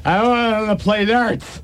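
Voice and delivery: put-on slow, high slurring drunk; slurred drunk. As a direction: drag the words like a drunk. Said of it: "his speech slurred".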